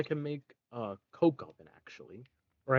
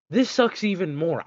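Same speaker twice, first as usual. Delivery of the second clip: exasperated, louder and more fed up; louder frustrated; 5 semitones higher, 7 dB louder than usual. Real, not acted. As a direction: let the frustration boil over. Frustration escalates at recipe.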